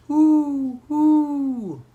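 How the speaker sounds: high, loud owl hoots. A narrator's reading. Owl startles two boys outside at night, high loud hooting. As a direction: hoot like an owl, long and falling. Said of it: "It's just an owl".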